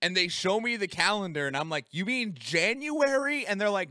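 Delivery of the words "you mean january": incredulous question. Retold or imitated. Confused in dream, retold.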